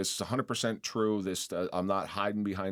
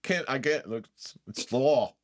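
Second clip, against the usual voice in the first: louder, higher re-enacted protest; insistent mock apology; officer refusing; 6 semitones higher, 5 dB louder than usual. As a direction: refuse firmly, playing the dutiful cop.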